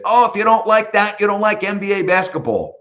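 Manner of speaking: high, loud fan imitation of his catchphrase; excited college kids. Son's friends yelling the announcer's catchphrase. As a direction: shout it like thrilled college kids. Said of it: "they said".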